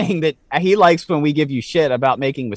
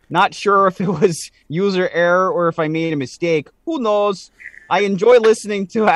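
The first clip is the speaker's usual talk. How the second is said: light mock Canadian accent, a bit higher; 4 semitones higher than usual. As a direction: read the email in a Canadian accent. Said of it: "I'm gonna read this in a Canadian accent".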